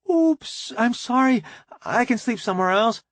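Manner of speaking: high, flustered apology; startled young woman backing out; flustered surprise. Acted. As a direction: blurt it out, caught off guard and embarrassed. Janet walks in on them.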